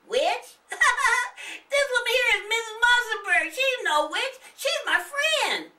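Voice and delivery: put-on high laughing little boy; exclaiming with a laugh. Nathan laughing that the woman is no witch, high exclaiming. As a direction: laugh off the mistake. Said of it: "Nathan exclaimed".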